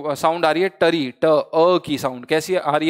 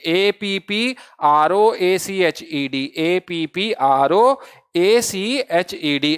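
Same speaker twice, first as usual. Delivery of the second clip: very slow, each letter of "ए पी पी आर ओ ए सी एच ई डी" spaced apart; slow spelling; much slower than usual. Shown as a demonstration. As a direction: dictate the spelling slowly so students can write it. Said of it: "approach is the correct word".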